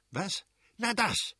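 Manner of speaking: high-pitched indignant outburst; acted irate exclamation; angry cry. A narrator's reading. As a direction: burst out, outraged, pitch leaping up. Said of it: "schrie der Fürst".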